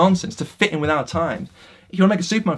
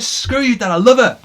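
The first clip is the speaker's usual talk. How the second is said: higher, louder cry of defiance; mock defiant shout; 7 semitones higher, 7 dB louder than usual. Superman defying his father to save Lois, loud defiant cry. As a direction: hurl it at the father in the clouds. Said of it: "But he does it".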